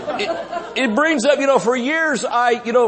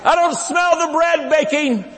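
shouted quip; 8 semitones higher than usual. Acted. Preacher's shouted aside.

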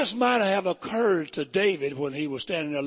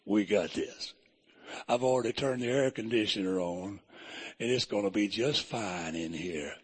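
a deep, easy reassurance from beside them; the Lord's voice; 8 semitones lower, 5 dB quieter than usual. Retold or imitated. The Lord comforts the three in the furnace, low and soft. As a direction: lower and softer, calm and reassuring. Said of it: "they heard somebody say".

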